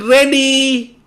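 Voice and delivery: high, loud little voice; child shouting back, high; child's voice. Acted. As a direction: pipe up like a small child. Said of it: "Baby said".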